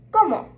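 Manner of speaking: ¿Cómo? said KOH-moh for the class to repeat; Spanish pronunciation. Shown as a demonstration. Teacher says ¿Cómo? for students to echo. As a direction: model it once. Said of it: "So repeat after me".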